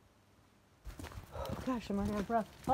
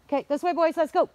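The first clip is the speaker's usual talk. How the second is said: loud, higher call to the dog; 8 semitones higher, 14 dB louder than usual. Real, not acted. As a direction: call Jack back to the path. Calling the dogs.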